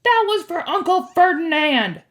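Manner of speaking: high, loud avenger's cry; defiant yell from above; yelled back. A narrator's reading. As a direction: shout it in triumph at a foe. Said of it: "Grover yelled back".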